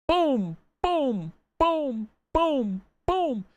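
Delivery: series of high, falling booms; big booms. Pretend. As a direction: punch out evenly spaced playful booms. Five big booms for speech abilities.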